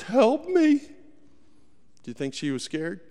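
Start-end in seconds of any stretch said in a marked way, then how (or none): 0.0-0.8 help me jumps much higher and louder, a mock terrified plea; retold desperate cry. Mother slipping in mud pulling her boy from the gator, desperate scream. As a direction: cry out to God mid tug-of-war with the gator. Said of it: "she's screaming".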